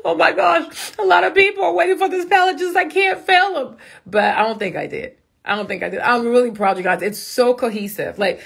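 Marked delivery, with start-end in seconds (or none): 0.0-3.8 high-pitched, worked-up panic; mock-panicked self-talk, pitched high; retold anxiety. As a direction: replay an anxious moment in a high, fretful voice. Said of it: "my anxiety was so bad".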